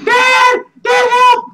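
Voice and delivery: put-on very high, loud little-kid yell; squeaky kid voice shouting help; child's cry for help. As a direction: shriek for Dad like a scared small child. Son character calls for help.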